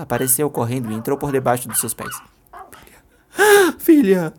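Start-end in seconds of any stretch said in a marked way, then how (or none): 2.7-4.3 high, loud cry of "Filha"; loud panicked mother's cry; frantic call. Acted. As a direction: call out desperately, much higher. Mother bursts in calling daughter.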